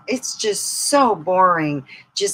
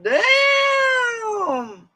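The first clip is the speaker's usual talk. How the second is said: surprised; 4 dB louder than usual. Retold or imitated. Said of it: "I'm like".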